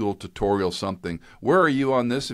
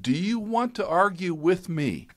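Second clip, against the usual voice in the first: much higher and slow, pointed retold challenge; stern dad challenge; 12 semitones higher than usual. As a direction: lean in slowly on each word, daring him. Dad challenging his son.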